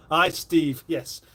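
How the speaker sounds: usual speaking voice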